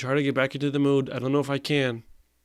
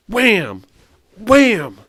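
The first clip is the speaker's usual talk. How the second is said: higher, louder burst on "Wham"; thrilled reaction shout; excited yell; 7 semitones higher, 8 dB louder than usual. Real, not acted. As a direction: slam the word Wham out loud. Repeating the ham sound.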